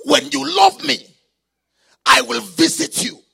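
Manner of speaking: loud, insistent preacher proclaiming a promise; full-force preaching. Real, not acted. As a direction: drive each promise home loudly to the congregation.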